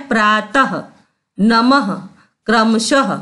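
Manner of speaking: "प्रातः, नमः, क्रमशः" each ending in an audible ha; visarga as h. Shown as a demonstration. Teacher demonstrates visarga, ending "प्रातः, नमः, क्रमशः" on ha. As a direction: sound the final two dots as h in "प्रातः, नमः, क्रमशः". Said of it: "उसका उच्चारण हम ह जैसा करते".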